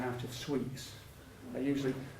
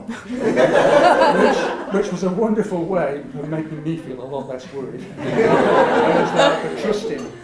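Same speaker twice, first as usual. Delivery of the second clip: loud, amused talk through laughter; louder words bubbling with laughter; laughing; 4 semitones higher, 14 dB louder, slower than usual. Real, not acted. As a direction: laugh as you remember the relief. Laughing after the doctor's quote.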